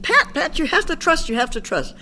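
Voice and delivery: high, urgent calling of Pat then insisting; retold yell. Sponsor yelling at distressed Pat to trust, mock yell. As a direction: call her name sharply, then press the point. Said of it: "she'd yell, yell at me".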